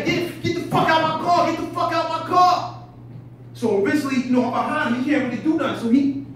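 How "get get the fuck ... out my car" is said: loud, high, angry yelling; furious shout. Retold or imitated. Fighting the intruder off, yelling to get out of the car. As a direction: scream him out of the car. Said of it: "So I'm just stinging him".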